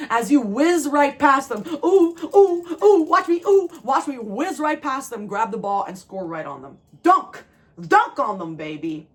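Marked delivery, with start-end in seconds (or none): 1.8-5.3 high, loud gloating ooh; sing-song victory taunt. Pretend. Taunting opponents while scoring. 7.0-9.1 shouted celebration; triumphant shout. Pretend. Celebrating imaginary score.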